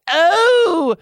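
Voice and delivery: cried out. Acted. Tamlin crying out as he comes. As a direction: let the climax cry out. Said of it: "Tamlin cried out".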